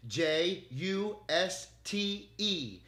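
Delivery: slow letter by letter spelling; spelling the name slowly again for the AI. Shown as a demonstration. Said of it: "just with an E at the end".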